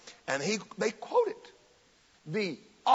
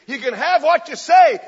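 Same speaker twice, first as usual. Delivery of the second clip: high, loud preacher's proclamation; Kenneth Hagin's preaching line; 9 semitones higher, 14 dB louder than usual. Retold or imitated. Preacher declaring his signature line to the crowd, loud and high. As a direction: call it out to a big congregation. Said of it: "Kenneth Hagin came along".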